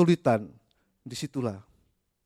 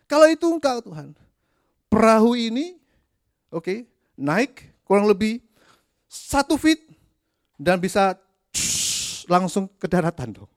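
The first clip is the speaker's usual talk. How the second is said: much higher, louder whiny demand; mocking Peter's demand; 8 semitones higher, 7 dB louder than usual. Preacher mocks Peter demanding that Jesus calm the storm, high and whiny. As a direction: test God like a pushy child.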